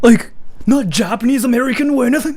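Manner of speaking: high, loud incredulous shout; shocked disbelief. Retold or imitated. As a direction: act stunned he's really Japanese. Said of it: "they go like".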